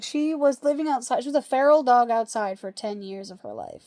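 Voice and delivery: ordinary talk, nothing marked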